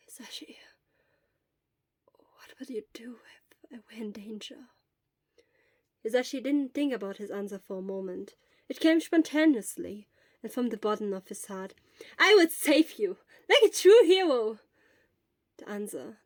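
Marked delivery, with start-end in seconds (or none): 0.1-4.7 breathy sick whisper, barely there; fading words of someone feverish; weak whisper. Acted. Sick Shota whispers question. 12.2-14.6 loud, high and proud; heroic declaration. Acted. Hisashi boldly promises heroics.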